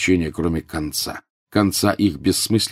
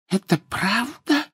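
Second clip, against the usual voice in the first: very high, slow woman's voice; hushed disbelief; slower than usual. A narrator's reading. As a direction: ask it in high, drawn-out dread. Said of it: "прошептала полная дама".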